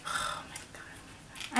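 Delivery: slow, breath-only whisper of disbelief; stunned whisper. Real, not acted. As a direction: whisper it slowly, overwhelmed. Stunned by the gift.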